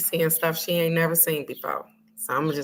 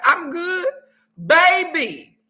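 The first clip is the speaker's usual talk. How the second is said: high, loud laughing exclamation; laughing loudly; 10 semitones higher, 4 dB louder than usual. Real, not acted. Telling viewers about spicy quesadillas, laughing loudly. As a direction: laugh out the words, much higher and louder.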